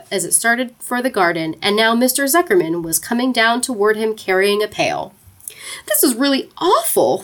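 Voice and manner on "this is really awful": higher, mildly upset thought; a touch of distress, voiced higher; understated woe of an unhappy young pig; distressed piglet. A narrator's reading. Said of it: "He began to cry".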